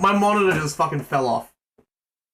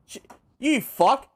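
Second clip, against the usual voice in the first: usual speaking voice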